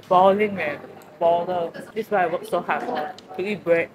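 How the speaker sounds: ordinary talk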